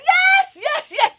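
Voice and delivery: high, loud triumphant yeses; jumping-up-and-down yes; excited yes. Retold or imitated. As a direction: squeal with victory. Cheering on the medal stand.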